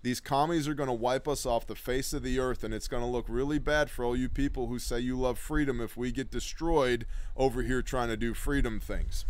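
ordinary talk, nothing marked

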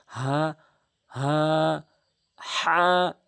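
হা held for one alif, long and clear; Arabic letter sounds. Shown as a demonstration. Tutor demonstrates the one-alif stretch to students, drawn out. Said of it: "এক আলিফ টান হবে".

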